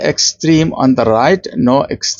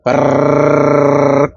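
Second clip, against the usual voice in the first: rapid motor brrr. Retold or imitated. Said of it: "talk without breathing".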